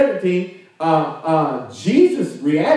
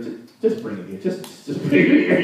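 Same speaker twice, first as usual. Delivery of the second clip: imagined weary Jesus, losing patience; exasperated. Acted. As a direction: sigh it out as if fed up. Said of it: "I'm looking at it like".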